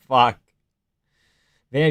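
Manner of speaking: ordinary talk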